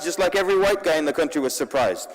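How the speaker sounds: ordinary talk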